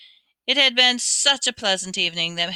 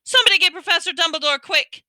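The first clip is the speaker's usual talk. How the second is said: high, loud, fast alarmed call; Percy sensing danger, sharp alarmed order; sharp and urgent; 11 semitones higher, 6 dB louder, faster than usual. A narrator's reading. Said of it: "in a suddenly sharp voice".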